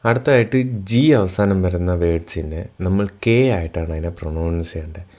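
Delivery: ordinary talk, nothing marked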